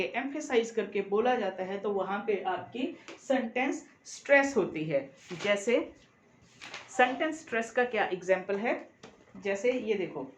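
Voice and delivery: ordinary talk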